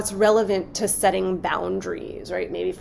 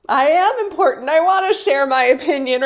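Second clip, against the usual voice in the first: high, loud, laughing self-important voice; mock self-important; 7 semitones higher, 7 dB louder, faster than usual. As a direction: puff yourself up, laughing at it. Mocking the ego's voice.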